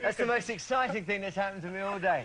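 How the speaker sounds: giggling through the words; real laughter breaking into the line; laughing. Real, not acted. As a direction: laugh as you say it, delighted. Host pinches guest's light bulbs.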